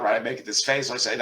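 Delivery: normal talking voice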